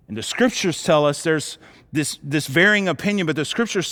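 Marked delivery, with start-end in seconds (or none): none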